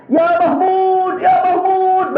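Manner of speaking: high, drawn-out call to the elephant; shouted call. Retold or imitated. Nufail crying out to the elephant Mahmud, high and drawn out. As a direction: call out to the elephant while running. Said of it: "dia teriak sambil berlari".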